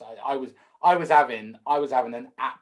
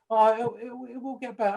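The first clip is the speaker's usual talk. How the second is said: gently soothing, pitched-up quote; imitated reassurance; 11 semitones higher than usual. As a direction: act out consoling a clumsy beginner in a much higher voice. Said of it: "She was like".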